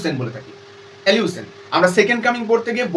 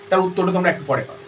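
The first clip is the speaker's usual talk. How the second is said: usual speaking voice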